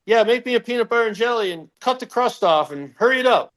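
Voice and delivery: high-pitched mock bossy order; playfully demanding, pitched well up; joking demand. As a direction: rattle off a cheeky order as a joke. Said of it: "Just a joke and nothing".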